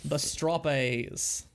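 drawn-out, mock Australian accent; fake Australian accent. Shown as a demonstration. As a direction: say it slowly in a bad Aussie accent. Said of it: "my bad fake Australian accent".

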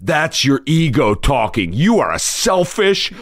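high, slow, drawn-out accusation; mock scolding. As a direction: imitate a meeting member laying into someone. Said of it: "the next guy crosstalked me".

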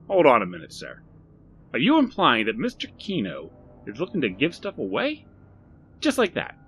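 Barry, suspicious. Acted. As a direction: push back like a wary salesman. Shopkeeper suspicious of the offer.

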